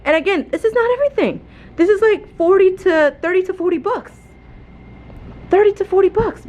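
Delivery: stressed out counting what's missing; frustrated. Real, not acted. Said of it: "you can hear it in my voice that I'm getting a little bit frustrated".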